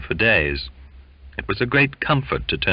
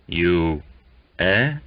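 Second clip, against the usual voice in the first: plain reading voice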